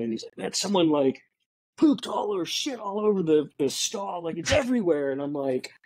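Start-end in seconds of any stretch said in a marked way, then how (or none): 0.4-5.1 high-pitched retold agitation; agitated janitor. Janitor complaining about the messed-up stall, agitated and high. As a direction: imitate a worked-up janitor, voice raised in pitch. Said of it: "kinda agitated".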